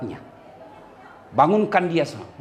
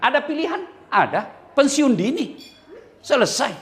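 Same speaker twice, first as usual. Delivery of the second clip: ordinary delivery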